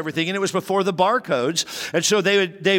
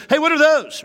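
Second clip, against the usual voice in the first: stern demand; 10 semitones higher, 5 dB louder than usual. Retold or imitated. Father confronts boys about pins.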